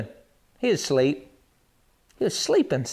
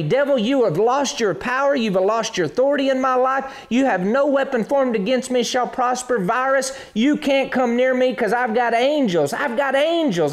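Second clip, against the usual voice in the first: raised, forceful rebuke of the devil; defiant faith declaration; bold declaration; 5 semitones higher than usual. Shown as a demonstration. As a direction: command the virus to leave. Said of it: "I'm gonna begin to say".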